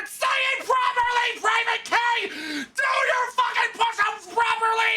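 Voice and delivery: very high, shouted drill sergeant; screaming sergeant. Retold or imitated. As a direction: scream it at the recruit. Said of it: "And you can go".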